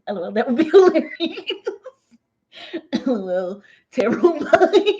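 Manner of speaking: laughing loud and high. Real, not acted. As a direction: burst out laughing. Laughing at reading joke.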